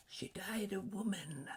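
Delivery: a gentle ghost reminding him; ghost's voice. A narrator's reading. Ghost speaks again.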